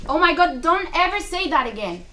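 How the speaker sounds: shocked mother begging her to stop; horrified cry. Acted. Mother answering her daughter's death wish, horrified loud plea.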